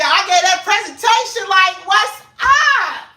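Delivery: high, loud, cocky; swaggering boast. Retold or imitated. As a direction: strut up to the podium. Bragging about presentation.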